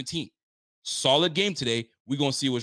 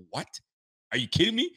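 surprised; 6 semitones higher, faster than usual. Real, not acted. Surprised by two sacks.

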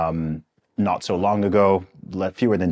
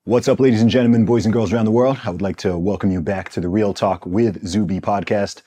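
fast, loud hype-man welcome; grand entrance announcement; 2 semitones higher, 4 dB louder than usual. Acted. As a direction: announce the guest like a show host. Podcast host opening intro.

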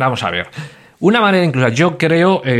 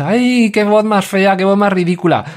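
self-conscious newbie, hammed up and higher; mock embarrassed voice; 7 semitones higher than usual. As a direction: drag out the dismay at your own recorded voice.

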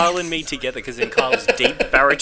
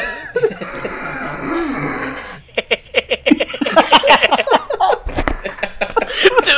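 imitated chuckle; 7 dB louder than usual. Shown as a demonstration. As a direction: copy a friend's chuckle, hammed up. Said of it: "I'll, uh, chuckle for you once".

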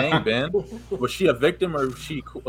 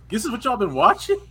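higher, louder disbelieving question; shocked stepdad; 11 semitones higher, 5 dB louder than usual. Retold or imitated. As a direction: stepdad catching kids, shocked. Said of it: "And he's like".

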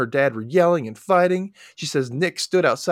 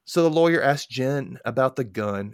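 ordinary talk

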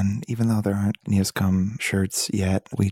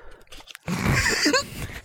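high laugh. Real, not acted. Laughs at the eviction papers.

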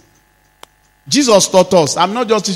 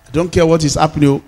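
usual speaking voice

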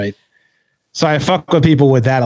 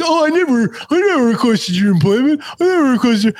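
high, whiny mock of a caught-out troll; playing the troll as a squeaky, panicked whiner; whiny flustered troll; 12 semitones higher than usual. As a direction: squeal the denial like a cornered heckler. Mocking troll in retold argument.

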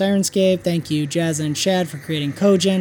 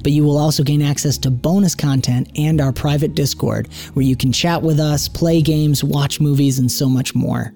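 podcast outro read; 4 semitones lower than usual. Acted.

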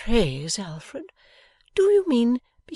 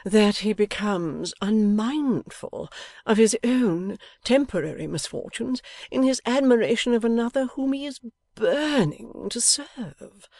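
innocent appeal; 6 semitones higher, 4 dB louder than usual. A narrator's reading. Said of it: "with an innocent air".

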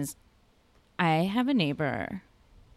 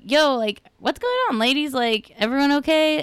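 high, checking-in call to neighbors; imitated calling out; 8 semitones higher than usual. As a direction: break into the quarrel with a raised pitch. Calls out to quarrelling neighbors.

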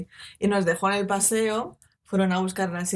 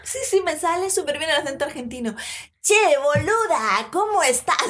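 mock a foreigner butchering Argentine speech; bad Argentine accent; 8 semitones higher, 4 dB louder than usual. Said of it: "muchísima gente que es como". (imitación burlona y exagerada de acento argentino, aguda y fuerte)

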